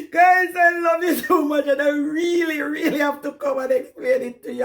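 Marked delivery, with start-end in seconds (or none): 0.1-1.7 emotional outburst. Real, not acted.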